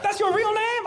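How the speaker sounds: high, squeaky disbelief. Retold or imitated. TSA worker doubting the passenger's name, very high voice. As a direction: ask it way up in falsetto. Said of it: "His voice went up even higher".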